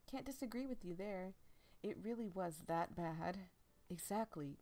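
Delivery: ordinary talk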